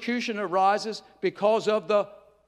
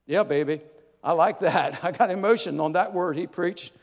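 ordinary talk

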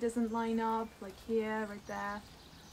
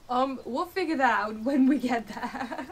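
laughing; 3 semitones higher, 7 dB louder than usual. Real, not acted. Laughing at cleaning problem.

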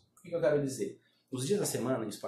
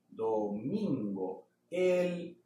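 slow teacher domingo; slow model; much slower than usual. Shown as a demonstration. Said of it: "Repete comigo".

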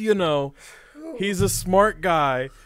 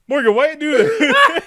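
high and louder "Morgan, why you do this"; hammed-up betrayal with a laugh; louder mock hurt plea; 9 semitones higher, 6 dB louder than usual. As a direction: wail it like a betrayed big guy. Voicing Sean louder.

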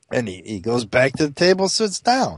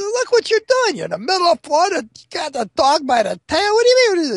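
high, incredulous angry scolding; acted exasperation. Barkeep telling off the blind customer, acted exasperated anger. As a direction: scold like you can't believe his nerve.

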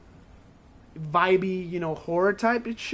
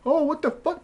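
startled; 8 semitones higher than usual. Retold or imitated. Player sees weird events.

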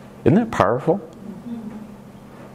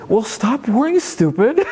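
mock scolding. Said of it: "To say".

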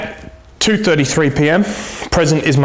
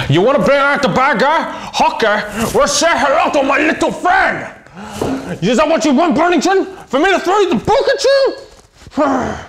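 much higher, strained villain voice; bad guy cop; 11 semitones higher than usual. Cop unleashing his bad guy side on the suspect, much higher menacing voice. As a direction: turn into the bad cop, pitched way up.

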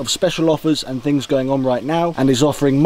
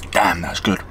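whisper; 6 semitones lower than usual. Real, not acted. Savouring food mid sign-off.

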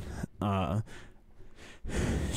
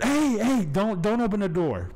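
grandma's high, loud cry of alarm; urgent warning shout; 11 semitones higher, 5 dB louder than usual. Retold or imitated. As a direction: shout to stop someone at the door, pitched way up. Grandma stops grandchild opening the door.

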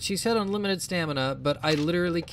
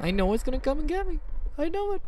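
anxious dread; 9 semitones higher, faster than usual. Real, not acted. Dreading monster around the corner.

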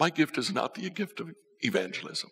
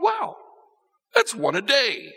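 high, amazed and a little louder; amazed wow; 9 semitones higher, 4 dB louder than usual. Retold or imitated. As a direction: marvel at the number like a discovery. Evangelist amazed at his count.

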